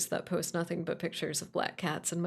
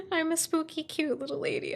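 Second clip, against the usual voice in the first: high, sweet little-lady voice; cutesy voice; 8 semitones higher than usual. Pretend. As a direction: play it cute and girly. Cutesy self-description.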